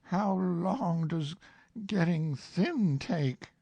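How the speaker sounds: worried question; anxious Pooh. Acted. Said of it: "asked Pooh anxiously".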